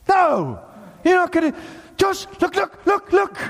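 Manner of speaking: high, urgent calls to a friend; excited disbelief. Acted. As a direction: spot rescue coming and cry out. Acting besieged citizen seeing rescuers.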